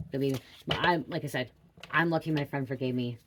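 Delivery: ordinary talk, nothing marked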